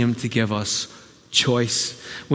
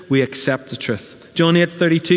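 ordinary talk

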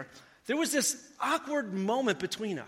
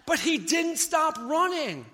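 ordinary talk

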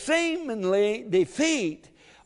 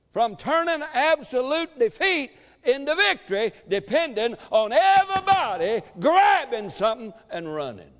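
full-force preaching; 6 semitones higher, 5 dB louder than usual. Real, not acted.

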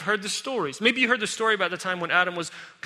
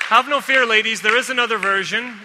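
loud reassurance; 3 semitones higher, 8 dB louder than usual. Real, not acted. Preacher calling out to the ladies in the room, loud and bright. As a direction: call out to reassure the women. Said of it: "addresses the ladies".

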